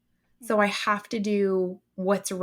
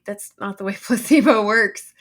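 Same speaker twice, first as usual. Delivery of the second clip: laughing retort; 9 dB louder than usual. Real, not acted. To people calling it placebo, laughing loud disbelief.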